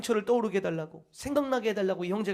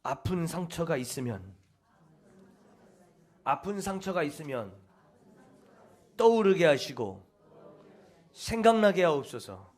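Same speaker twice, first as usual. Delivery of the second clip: recited prayer; 4 semitones lower than usual. Shown as a demonstration. Preacher demonstrates the healing prayer to the congregation, intoning. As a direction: intone it like a prayer, pressing harder at the end. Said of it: "이것이 치유 기도문이에요".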